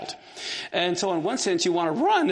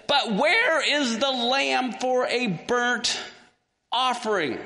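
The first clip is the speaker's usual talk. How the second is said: slow, higher-pitched questioning; a son pressing his father for an answer; insistent question; 5 semitones higher, slower than usual. Acted. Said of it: "he wants to know".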